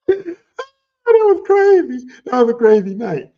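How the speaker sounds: high, slow laugh-cry; crying with laughter; laughing so hard. Real, not acted. Remembering brother's forehead, crying with laughter.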